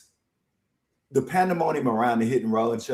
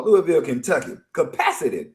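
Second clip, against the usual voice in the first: usual speaking voice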